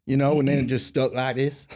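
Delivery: put-on Louisiana-Texan twang; hammed-up Southern accent. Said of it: "started talking like this over time".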